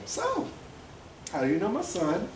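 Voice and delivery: high-pitched mom sizing up a visitor; mom interrogation. Retold or imitated. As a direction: open high, then probe like a mother. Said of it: "the classic, like, mom breakdown".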